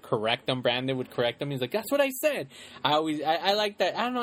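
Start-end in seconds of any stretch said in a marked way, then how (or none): 1.7-2.5 indignant retort. Retold or imitated. Quoting Mikey's retort when corrected.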